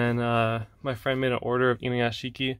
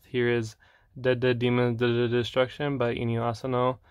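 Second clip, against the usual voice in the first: normal talking voice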